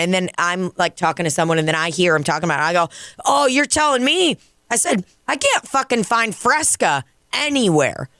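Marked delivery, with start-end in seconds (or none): 3.2-8.1 high-pitched retold exasperation; exasperated complaint. Bartender chiming in on missing groceries, exasperated complaint. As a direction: commiserate emphatically, pitched well up. Commiserating, retelling own complaint.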